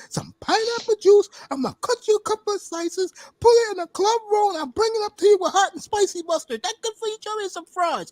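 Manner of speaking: high, strained chef voice; excited chef. Retold or imitated. Strip club chef offering Chubby fresh ham, high excited voice. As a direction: pitch it way up, proudly pitching the dish. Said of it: "then the chef comes in".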